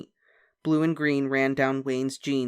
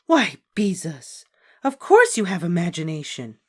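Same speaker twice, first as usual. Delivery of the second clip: shocked; 4 semitones higher, 7 dB louder than usual. A narrator's reading. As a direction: gasp it out, truly taken aback. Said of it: "Miss Robin sounded shocked".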